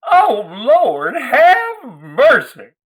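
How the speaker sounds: high, loud, drawn-out phony Southern shriek; shrieking Southern belle. Acted. Gwendy and Janice pointing at Lloyd, high loud fake Southern shriek. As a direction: squawk it out, mock-scandalized. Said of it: "they shrieked".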